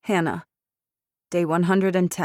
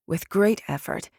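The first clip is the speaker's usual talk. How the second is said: usual speaking voice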